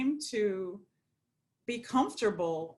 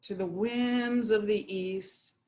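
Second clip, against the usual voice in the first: meditative invocation; 4 semitones lower than usual. Acted. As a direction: call to the spirits reverently, phrase by phrase. Recites blessing to the four winds.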